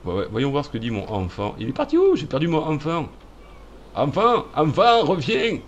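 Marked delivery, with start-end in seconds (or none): 1.6-5.6 calling out. Pretend. Calling lost game child.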